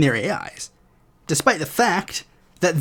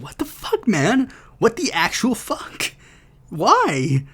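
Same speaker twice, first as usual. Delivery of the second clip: much higher, incredulous outburst; mock exasperation; 5 semitones higher, slower than usual. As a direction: play the baffled, fed-up Democrat. Voicing furious House Democrats.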